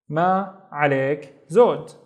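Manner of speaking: ma, عليك, زود with pauses between; word by word. Shown as a demonstration. Teacher giving the polite response, ma عليك زود word by word.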